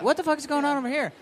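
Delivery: mock bewilderment, quick and high; baffled passer-by.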